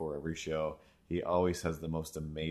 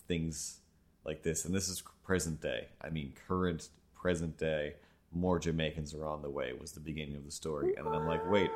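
usual speaking voice

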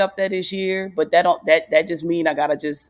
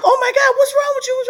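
much higher, laughing mock alarm; dresser's alarm; 4 dB louder than usual. The person who dresses her reacting to her tears, high laughing alarm. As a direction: squeal it like a startled friend. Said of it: "she's like".

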